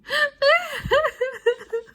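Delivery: very high, loud wailing sobs; a whole crowd howling at once; chorus of sobbing. A narrator's reading. The Twaddle children all set off sobbing again. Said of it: "a symphony of sobbing".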